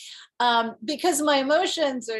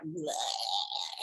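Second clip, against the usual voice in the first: deflated bleh; 6 semitones lower, 8 dB quieter than usual. Retold or imitated. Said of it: "the next day I'm like".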